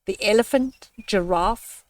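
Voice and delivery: ordinary delivery, nothing marked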